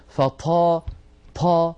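"فطا، طا" with a puff of air after each ط; aspirated, breathed ṭa. Shown as a demonstration. Teacher exposing the error to students, "طا" with escaping breath. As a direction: push air out on the ط of "طا". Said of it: "هل سمعتم هذا الهواء".

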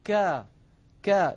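كا said light and forward, with the sound falling out of the mouth; light kaf demo. Shown as a demonstration. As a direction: model a thin كا with no emphasis. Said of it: "بينما على اليسار كا". (كا مرققة للتوضيح)

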